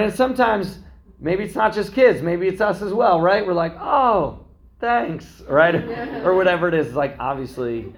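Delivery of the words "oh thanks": high-pitched, half-hearted mock thanks; unenthused thanks. Receiver opening a disappointing gift, not fired up. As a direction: act out polite but underwhelmed gratitude. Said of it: "you're not fired up about this".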